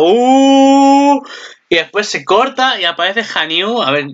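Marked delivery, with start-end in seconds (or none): 0.0-1.2 sharp, high, loud uh; a startled yelp; shocked uh. Retold or imitated. (gemido agudo y fuerte de sorpresa)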